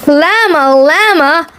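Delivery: ordinary delivery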